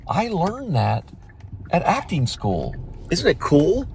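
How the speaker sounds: high, slow, creepy-sweet voice; mock Sarah Silverman. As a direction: taunt him sweetly and slowly. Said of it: "She's like".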